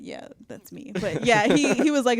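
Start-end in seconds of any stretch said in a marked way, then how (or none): 0.0-0.9 flat whispered yes. Retold or imitated. Answering store calls as herself.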